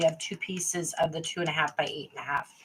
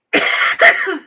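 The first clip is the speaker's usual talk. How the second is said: harsh airless cough, pretzel stuck in the throat; real choking cough; 11 semitones higher, 10 dB louder than usual. Real, not acted. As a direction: cough hard to clear the throat. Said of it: "pretzel stuck in my throat".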